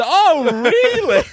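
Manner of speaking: loud, high, drawn-out mock astonishment; feigned surprise. Customer acting as if free coffee is news, hammed-up surprise. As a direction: overact being amazed by the news. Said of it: "I've gotta act as".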